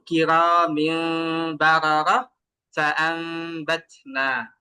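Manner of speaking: slow drawn-out tajweed recitation; Quranic recitation demo. Shown as a demonstration. Teacher recites the iqlab example slowly to students. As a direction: recite slowly, merging into the mim. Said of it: "যেমন".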